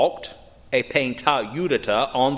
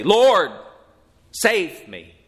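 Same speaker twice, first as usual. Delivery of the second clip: louder, higher cry on Lord, then dropping on save me; earnest short prayer; 5 semitones higher, 4 dB louder, much slower than usual. Shown as a demonstration. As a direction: call out Lord, then settle into a plain plea. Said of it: "The only type of prayer that a saved person can pray".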